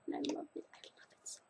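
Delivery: breathy whisper of delight; whispered gush. Real, not acted. Gushing to viewers about her nail lamp, whispered. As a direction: whisper it like a happy secret.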